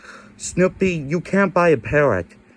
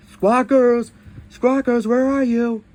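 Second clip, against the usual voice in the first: loud call for the lost parrot; calling out loudly; 5 semitones higher, 3 dB louder than usual. Pretend. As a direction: call out across the house.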